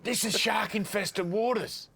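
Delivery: high, slow exasperated holler; mock nervous breakdown. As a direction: imitate a boatman at the end of his tether, drawn out. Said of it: "The bloke had a nervous breakdown".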